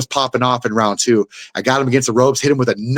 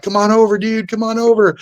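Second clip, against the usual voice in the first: high, called-out mock cornermen; corners calling over; 10 semitones higher than usual. Cornermen calling the fighter back to the corner, high call. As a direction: wave him over, pitched high. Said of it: "They're like".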